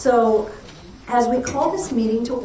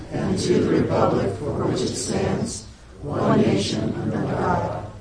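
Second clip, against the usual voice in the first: pledge recitation. Acted.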